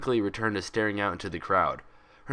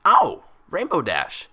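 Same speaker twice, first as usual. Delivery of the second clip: loud declaration; 7 semitones higher, 9 dB louder, slower than usual. Acted. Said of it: "she declared as recognition dawned".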